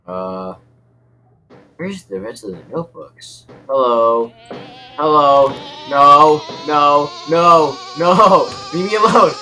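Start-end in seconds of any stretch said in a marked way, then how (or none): 3.7-9.3 alarmed shouting. Real, not acted. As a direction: shout them away, getting more panicked. Cornered by game character.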